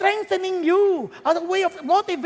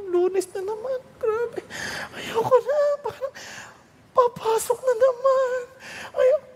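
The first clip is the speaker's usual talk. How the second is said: Monday whine; 8 semitones higher than usual. Acted. Whining on Monday morning.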